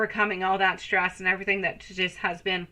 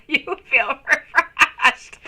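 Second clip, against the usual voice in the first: slow, laughing tease; laughing through it; 7 semitones higher, 7 dB louder, much slower than usual. Real, not acted. To a viewer after her sage cleansing, said laughing. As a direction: chuckle the words out.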